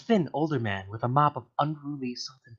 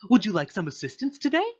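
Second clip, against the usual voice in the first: high, bouncy, chirpy helper voice; 9 semitones higher, 4 dB louder than usual. Acted. Alien paperclip greets the startled writer in a high bouncy voice. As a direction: sound like an eager little assistant. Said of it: "A high-pitched, bouncy voice".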